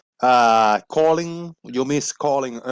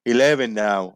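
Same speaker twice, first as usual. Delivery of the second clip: ordinary talk, nothing marked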